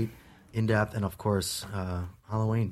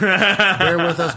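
laughing; 8 semitones higher, 10 dB louder, slower than usual. Real, not acted. Laughing at cohost.

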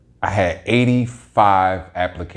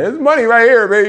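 very high sing-song lure; tempting call; 9 dB louder than usual. Retold or imitated. Corner money calling out to him, very high and tempting. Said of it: "Calling me, like".

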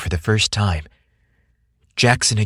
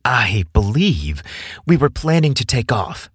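mischievous; 3 semitones higher than usual. A narrator's reading. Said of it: "in a mischievous tone".

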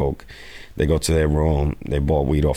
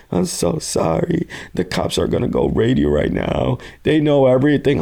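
put-on high, frantic apology from a girl in a cell; panicked girl; 9 semitones higher than usual. Girl calls from the precinct to warn him, high frantic voice. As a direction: plead it, voice pitched way up in panic.

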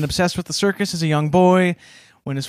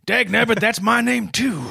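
put-on old-timey army guy; 4 semitones higher than usual. Acted army guy reacting.

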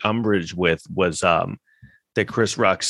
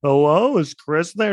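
mock phone call; 8 semitones higher than usual. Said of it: "mocking phone call".

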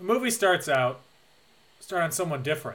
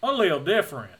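hammed-up Texas accent; Southern drawl; 3 semitones higher, 4 dB louder, faster than usual. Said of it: "His drawl is so thick".

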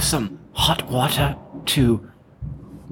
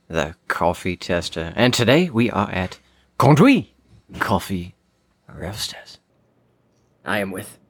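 ordinary delivery

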